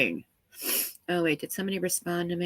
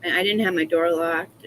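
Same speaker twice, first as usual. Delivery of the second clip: normal talking voice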